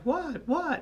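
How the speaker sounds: two high, quick questions; puzzled women. Retold or imitated. Girls come in on the laughing men, high puzzled asking. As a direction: pitch it way up, baffled. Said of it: "and they're like".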